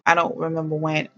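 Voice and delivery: usual speaking voice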